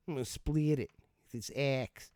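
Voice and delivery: amused put-on Boston woodcutter; mock Boston accent. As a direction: play a Bostonian, breaking into a laugh first. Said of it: "they wouldn't be talking like that in Boston".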